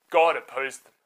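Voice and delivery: plain reading voice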